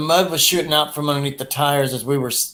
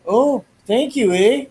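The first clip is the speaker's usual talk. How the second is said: high, slow Canadian accent; 10 semitones higher, slower than usual. Shown as a demonstration. Asking a Canadian friend, mock Canadian accent, high and slow. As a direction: ham up a Canadian thank you. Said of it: "strong Canadian accent".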